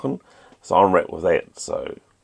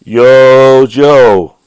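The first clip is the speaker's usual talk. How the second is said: big, high, loud "Yo, Joe!" battle cry; catchphrase shout; 7 semitones higher, 14 dB louder than usual. Pretend. Signing off to viewers with a loud, high-pitched battle cry. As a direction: belt it out like a rallying call. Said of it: "it's time for me to say".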